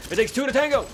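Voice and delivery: high, loud sing-song shout; playful shout. Real, not acted. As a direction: belt it out like a silly cheer. Playful shout during game fight.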